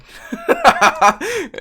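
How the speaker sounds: high, loud burst of laughter; real laugh. Real, not acted. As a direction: crack up at your own story. Cracking up at own gloating.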